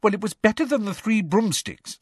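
put-on high-pitched, defensive girl's voice. A narrator's reading. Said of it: "said Hermione defensively".

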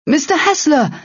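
high-pitched cry of alarm. A narrator's reading. Onlooker crying out as Hessler vanishes, alarmed cry. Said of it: "came a cry".

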